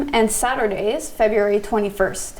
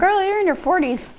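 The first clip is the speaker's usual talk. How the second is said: teasing; 9 semitones higher than usual. Real, not acted. Teasing co-host, high.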